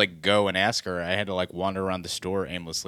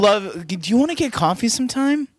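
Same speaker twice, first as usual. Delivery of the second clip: higher, animated mock invitation; re-enacted coffee invitation; 10 semitones higher, 5 dB louder than usual. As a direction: act out asking someone out, pitched up. Re-enacts asking her out.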